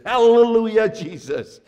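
loud burst of praise, then dropping; joyful hallelujah. Retold or imitated. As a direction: shout hallelujah as if just healed. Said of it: "she said like this".

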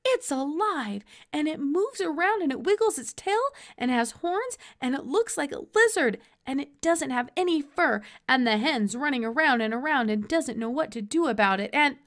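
excited babble. A narrator's reading. Boy blurting news breathlessly.

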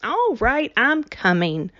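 high exasperated all right; exasperated cry. A narrator's reading. Winnie answering her mother, exasperated and high. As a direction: snap back, fed up. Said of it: "she cried, exasperated".

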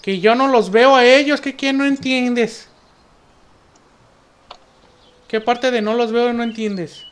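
slow, annoyed, spelling it out; what part don't you get, slowly; exasperated. Real, not acted. Teammate keeps asking where.

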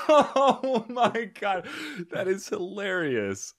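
slow, high, laughing oh my God; laughing disbelief. Real, not acted. Host cracking up at the French story, slow and laughing. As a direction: stretch it out through laughter.

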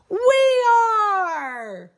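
high gasping delighted cry; excited gasp. Acted. Host telling the children they are the swaying ones. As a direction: gasp then cheer the answer. Kids song reveal.

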